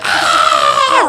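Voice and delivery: high loud fangirl shriek; fan scream. Retold or imitated. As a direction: scream like a teen at a pop concert. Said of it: "was like".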